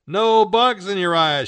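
high, loud little announcement; emphatic instruction. A narrator's reading. Barbara puts goggles on Marmaduke, emphatic. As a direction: declare it firmly to the dog. Barbara briskly outfits the dog.

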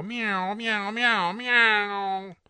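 high meowing cat noises from the TV. Acted. TV cat Fluffy meowing for help, high meows. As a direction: meow like a cartoon cat on TV.

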